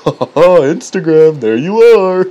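loud, high mock discovery; feigned delight. Pretending to read phone apps, mock delight. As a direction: greet the app like a long-lost friend.

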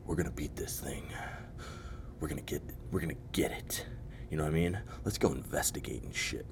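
hushed, bold whisper; brave movie guys whispering. Retold or imitated. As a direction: whisper like a cocky movie hero. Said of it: "the rest are like".

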